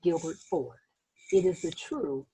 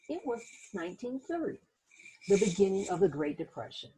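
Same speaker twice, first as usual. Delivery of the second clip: put-on gentle read-aloud narration for children; teacher reading a picture book aloud; story narration; 3 semitones higher than usual. A narrator's reading. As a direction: tell it like a bedtime storybook.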